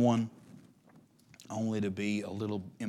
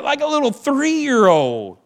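high loud mocking; 14 dB louder, slower than usual. Still in mock wail.

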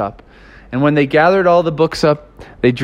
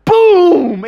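high, loud storyteller boom; big boom; 14 semitones higher, 4 dB louder than usual. A narrator's reading. Storyteller hits the wave crash, loud and high. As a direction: slam the word out like the wave hitting.

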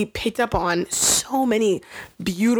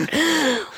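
shocked gasp; 8 semitones higher than usual. Retold or imitated. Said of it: "Sam's like".

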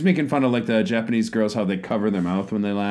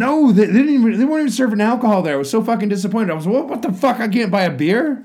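high, loud outraged rant; indignant, voice jumping up; 10 semitones higher, 5 dB louder than usual. Real, not acted. Outraged no alcohol served.

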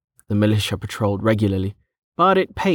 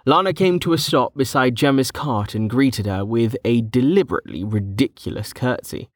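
usual speaking voice

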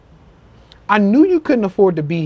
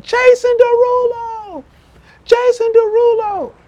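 crowd calling out; slower than usual. Retold or imitated. Fans yelling from stadium top.

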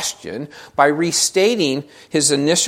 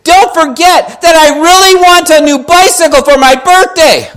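put-on high, full-volume child's yell; shouting little boy; 14 semitones higher, 13 dB louder than usual. Little boy shouting his birthday wish in prayer, very loud. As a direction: belt out the prayer like a kid. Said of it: "But then he shouted".

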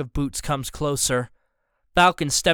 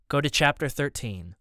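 ordinary delivery

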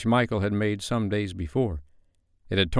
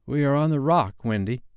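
a hint of a boy, pitched higher; lightly voiced Peter; 6 semitones higher than usual. A narrator's reading. Peter telling Wendy the truth, lightly voiced higher. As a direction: lightly play a boy breaking bad news.

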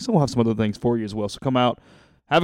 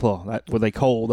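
ordinary talk, nothing marked

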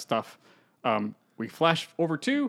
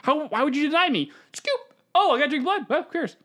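flippant high mock voice; 9 semitones higher than usual.

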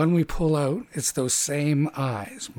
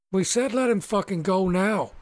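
higher, menacing demand; threatening halfling; 6 semitones higher than usual. Acted. Halfling crowd warning the party to let him go, menacing demand.

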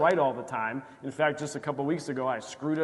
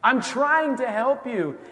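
exasperated God; 8 semitones higher, 6 dB louder, slower than usual. Retold or imitated. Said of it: "And God's like".

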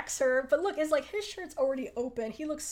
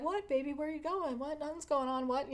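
mock innocent boyfriend; lightly imitated cover-up; feigned innocence; 3 semitones higher than usual. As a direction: play dumb in short, higher questions. Imitating Stamos covering up nervously.

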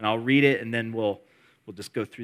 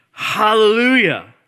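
high, loud ringing shout; crying out hallelujah; 9 semitones higher, 12 dB louder, slower than usual. Acted. Pastor voicing heavenly multitude to congregation, shouting. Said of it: "like the roar of many waters... crying out".

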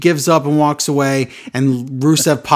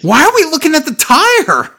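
incredulous; 11 semitones higher, 3 dB louder than usual. Real, not acted. Baffled by pointless tire close-up.

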